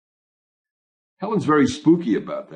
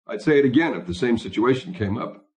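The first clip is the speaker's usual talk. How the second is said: ordinary delivery, nothing marked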